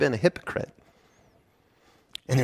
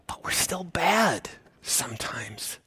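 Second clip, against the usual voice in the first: much softer, slow whisper; whispered confession; 11 dB quieter, slower than usual. Real, not acted. Preacher admitting believers still sin, in a whisper. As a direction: breathe it like a secret.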